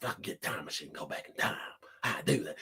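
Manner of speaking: very low, hushed, wistful murmur; Uncle Rico. Acted. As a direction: brood quietly over lost glory days. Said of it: "that's how Rico sound when he's talking".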